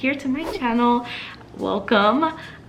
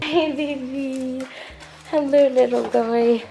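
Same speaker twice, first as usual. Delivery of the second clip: puppy talk; 3 semitones higher, slower than usual. Real, not acted. Talking to the puppy.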